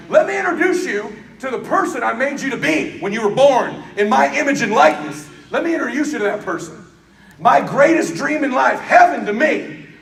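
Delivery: loud, high, driving sermon delivery; pumped-up motivational sermon, almost shouting; fiery preaching. Acted. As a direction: preach it to the crowd at full force. Preaching at full force again.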